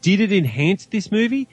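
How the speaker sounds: ordinary talk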